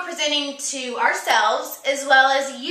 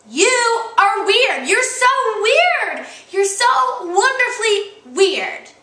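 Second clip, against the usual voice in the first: slow, loud and emphatic; 6 semitones higher, 6 dB louder, slower than usual. Acted. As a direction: declare it proudly, drawn out. Building emphasis on being weird.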